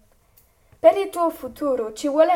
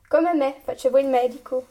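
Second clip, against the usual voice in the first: ordinary talk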